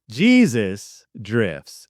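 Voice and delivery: a hint of a movie-trailer voice; mock ominous. Shown as a demonstration. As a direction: intone it like a solemn prophecy. Reading out his word-search fortune.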